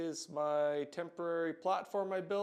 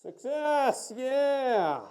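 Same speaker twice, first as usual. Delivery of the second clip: excited cheer; 9 semitones higher, 9 dB louder, much slower than usual. Real, not acted. Breaks through concrete wall.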